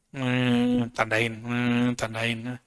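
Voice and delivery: sleepy, fading murmur as he counts; drowsy counting. Shown as a demonstration. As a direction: mumble it like dozing off. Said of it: "Coba antum begini-gini". (gumaman mengantuk yang makin pelan)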